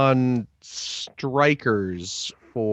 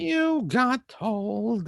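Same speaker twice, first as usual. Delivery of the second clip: slow, drawn-out singsong taunt; mocking taunt; 9 semitones higher, much slower than usual. Real, not acted. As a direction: rub it in slowly, teasing. Teasing co-host again.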